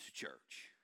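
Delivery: whispered punchline after a pause; hushed reveal. Real, not acted. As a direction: drop to a near whisper to reveal it. Answer to own question, hushed.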